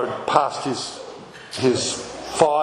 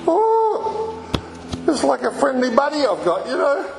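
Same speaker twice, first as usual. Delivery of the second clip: much higher put-on voice, cooing over a pet sin; mock fond attachment; 10 semitones higher than usual. Preacher mocking someone fond of their sin, high cooing voice.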